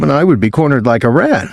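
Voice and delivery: usual speaking voice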